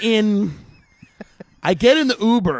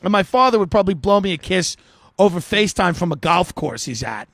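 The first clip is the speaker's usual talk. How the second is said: usual speaking voice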